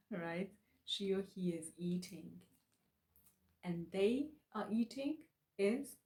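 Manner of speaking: ordinary talk, nothing marked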